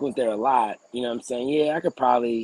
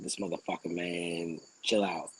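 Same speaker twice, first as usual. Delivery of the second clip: under his breath; 6 semitones lower, 9 dB quieter, slower than usual. Retold or imitated. Muttering to himself.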